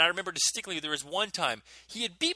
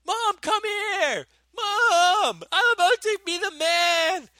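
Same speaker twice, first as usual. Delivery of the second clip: much higher, loud, slow triumphant yell; yelling for mom; 7 dB louder, much slower than usual. Retold or imitated. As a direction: crow for mom like you've won. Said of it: "So I start yelling".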